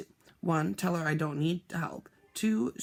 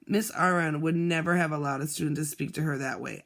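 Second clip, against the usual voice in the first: ordinary delivery, nothing marked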